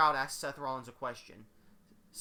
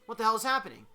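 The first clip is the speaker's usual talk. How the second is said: startled; 5 semitones higher, 4 dB louder, faster than usual. Acted. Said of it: "a little distracted by the commotion".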